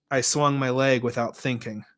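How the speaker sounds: ordinary delivery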